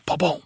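punchy ba-boom; loud mock punch noise; punch sound effect.

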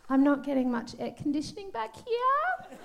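put-on soft, sweet girly voice; girl voice. Shown as a demonstration. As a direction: put on a helpless little-girl tone. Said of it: "I use my girl voice to get what I want".

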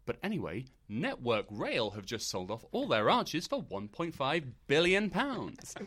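drawn-out, slow newsy link, last words swooping up; peculiar presenter voice. Retold or imitated. Mimicking presenter moving on from Cockney impression, peculiar voice. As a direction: stretch the figure out, rising on the payoff. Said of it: "her voice went really peculiar".

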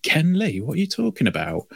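high baffled Ken Lee; mock puzzled judge. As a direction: play the confused judge. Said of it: "It's like".

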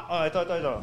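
normal talking voice

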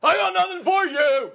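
high, loud, slow country hermit yell; shouted country accent. Acted. Acting out his forest-veteran role, loud country yell. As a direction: bellow I got nothing for you like a backwoods hermit. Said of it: "country accents".

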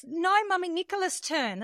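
put-on high-pitched little-kid insistence; imitated toddler son. Son claims his turn to sing, imitated toddler insistence. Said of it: "No, he goes".

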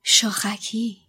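breathy whisper of one word; whispered name. Acted. Harry recognising the stag patronus, whispering. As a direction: murmur it in awe. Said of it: "زیر لب گفت".

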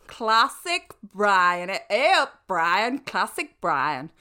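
Classic Brian loud and slow in a hammed-up northern accent; a badly faked northern accent, loud and slow; mock northern accent. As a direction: boom Hey up Brian like a Yorkshireman. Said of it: "I wish I could do the accent like you".

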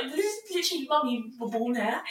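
high, exasperated disbelief. Retold or imitated. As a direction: plead in disbelief. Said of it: "it's just like".

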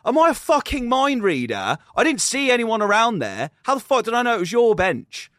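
high, loud, exasperated rant; acted-out incredulous anger; indignant retort. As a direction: re-enact arguing back in outrage. Retold angry reply in gym row.

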